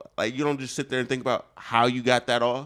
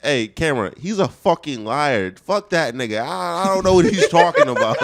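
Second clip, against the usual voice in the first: mock outraged rebuttal; 2 semitones higher, 8 dB louder than usual. Loud rebuttal to camera.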